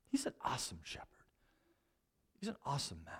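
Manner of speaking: almost whisper it in admiration; hushed awe. Real, not acted. Awe at Moses.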